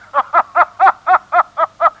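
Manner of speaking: hoog en luid schateren; schaterlach. Acted. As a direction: lach hem vierkant uit. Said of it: "Ja, hij lacht". (Makke schatert om de dronken Loeris)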